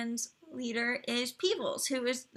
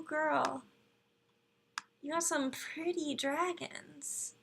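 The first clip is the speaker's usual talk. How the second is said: ordinary talk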